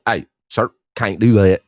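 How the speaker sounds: low country voice, loud at the end; stern country-boy telling-off. Acted. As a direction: put on your best country twang. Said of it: "in my best country voice".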